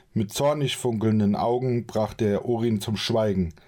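ordinary talk, nothing marked

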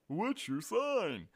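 slow, high, loud mockery; exasperated mocking repeat. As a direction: parrot the question back in disgust, drawn out.

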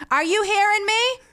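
put-on calling from another room, Maine accent; mom calling out. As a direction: yell it across the house like mom. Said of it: "when she would call to us".

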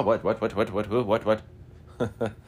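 rapid mock-startled babble; startled waking. Retelling being woken up while lost in thought, mock startled. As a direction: imitate being jolted out of a daze.

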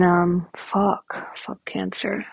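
usual speaking voice

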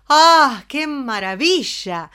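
delighted cry of wonder; happy exclamation. Shown as a demonstration. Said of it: "Cuando estamos felices decimos". (exclamación feliz y entusiasmada)

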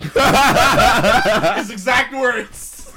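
words squeezed out through laughter; can barely speak for laughing; laughing hard. Real, not acted.

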